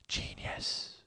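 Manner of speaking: hushed, voiceless "genius"; barely audible, breath-only whisper; whispered echo. Real, not acted. Hushed echo of his praise.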